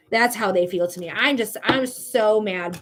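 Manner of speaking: ordinary talk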